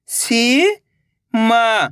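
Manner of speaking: Seema stretched very slowly, each sound held long. Shown as a demonstration. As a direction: drag out Seema for the learner. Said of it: "तो मिलके बन जाएगा". (सीमा को बहुत धीरे खींचकर बोलें)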